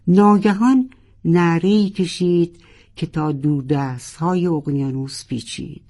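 plain reading voice

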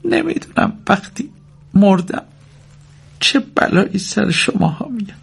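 very high, thin motherly wail; thin high voice, halting. A narrator's reading. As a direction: speak in a tiny, high voice. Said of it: "مادر با صدای نازکی گفت".

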